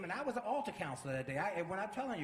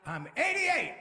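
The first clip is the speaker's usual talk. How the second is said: high, loud proud exclamation; elder crowing it out loud; exclaimed reveal; 12 semitones higher, 7 dB louder, slower than usual. Retold or imitated. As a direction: burst upward on the age. Older minister repeats age emphatically.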